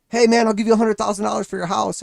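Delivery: pushy neighbor over the fence; mock yelling neighbor. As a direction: play the neighbor hollering an offer. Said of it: "your neighbor yelling at you".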